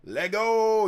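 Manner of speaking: loud, high, stretched-out hype call; hype shout. Real, not acted. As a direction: pump up the chat. Hyping the donation goal.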